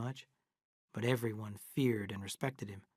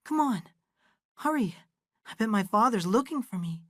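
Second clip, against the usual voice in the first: much higher, urgent and a bit louder; hurried; 9 semitones higher, 3 dB louder than usual. Acted. Girl Abra hurrying Aaron home before her father looks. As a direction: rush him, worried about father.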